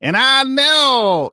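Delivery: mocking Hillary Clinton preaching to a church; mock preacher. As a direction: draw it out like a sermon opener. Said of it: "she does the same thing".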